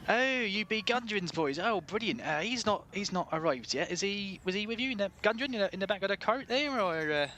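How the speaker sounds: high, lilting West Country shopkeeper; delighted greeting in broad West Country; West Country accent. Acted. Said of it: "best West Country accent this is".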